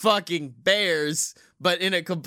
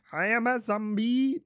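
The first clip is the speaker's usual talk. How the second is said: laughing, higher put-on zombie voice; 3 semitones higher than usual. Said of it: "that's not [name] at all".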